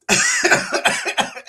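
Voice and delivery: sudden high-pitched laugh; high burst of laughter. Real, not acted. Laughing at chucking the cat.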